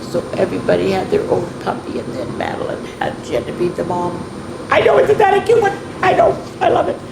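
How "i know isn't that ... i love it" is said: much higher, fast and louder; delighted gushing. Real, not acted. Showing the children's book, much higher and gushing. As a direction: gush over how cute it is.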